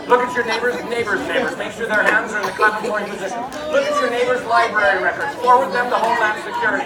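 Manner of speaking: loud, fired-up comic commands; mock-urgent orders. Performer policing non-clappers in the audience, mock-urgent. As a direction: escalate into mock alarm at the crowd.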